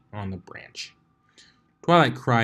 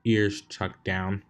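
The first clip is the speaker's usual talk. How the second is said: usual speaking voice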